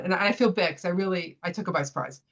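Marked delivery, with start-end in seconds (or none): none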